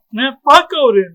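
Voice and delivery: cursing Odin after the betrayal, angry; mock angry outburst. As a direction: spit it out at the villain. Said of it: "So I was like".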